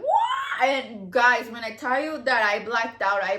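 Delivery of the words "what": sudden high shrieked what; a sharp startled scream; retold shout. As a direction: yell it out in shock. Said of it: "like, going, like".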